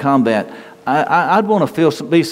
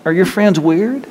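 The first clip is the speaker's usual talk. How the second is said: teasing dad; 9 semitones higher than usual. Retold or imitated. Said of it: "I always turned it around".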